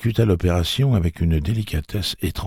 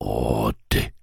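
low, croaky mumble; creature mutter; 4 semitones lower than usual. A narrator's reading. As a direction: mutter it like a beast. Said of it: "marmonna le bafouilleux".

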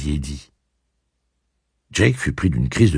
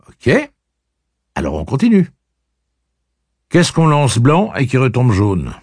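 cocky taunting; 8 semitones higher, 6 dB louder than usual. Acted. Eddie taunting riddle.

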